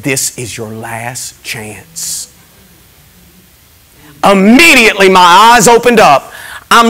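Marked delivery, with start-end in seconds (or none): 0.0-2.3 low, soft and slow divine warning; grave whispered warning; solemn quiet voice. Retold or imitated. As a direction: hush it like a voice from beyond. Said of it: "ever forget this voice".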